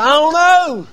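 retelling answering his wife, amazed; baffled. Retold or imitated. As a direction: still amazed by it. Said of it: "I said".

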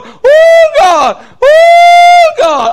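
high whoops broken by a loud cry of God; retold shouting. Preacher voicing Marvin at the altar, loud high whoops. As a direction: cry out to God at full volume, overcome. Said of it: "he was down there going".

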